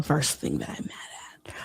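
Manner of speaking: hushed, breathy aside; whispered aside. Real, not acted. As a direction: drop to a whisper.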